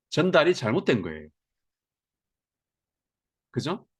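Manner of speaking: normal talking voice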